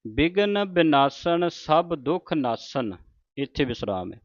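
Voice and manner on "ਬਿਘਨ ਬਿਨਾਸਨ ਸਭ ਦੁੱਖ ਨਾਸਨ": ਬਿਨਾਸਨ and ਨਾਸਨ with a plain dental ਨ; slow, ਨ sounds clear and dental; ਨੰਨੇ ਦੀ ਆਵਾਜ਼ ਨਾਲ. Shown as a demonstration. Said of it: "ਨੰਨੇ ਦੇ ਆਵਾਜ਼ ਉਚਾਰਨ ਹੋਵੇ".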